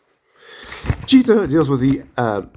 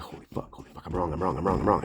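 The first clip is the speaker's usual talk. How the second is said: hushed, awed whisper of realization; stunned whisper; 6 semitones lower, 10 dB quieter than usual. Retold or imitated. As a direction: breathe it out in shock. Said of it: "Everybody in that group was like".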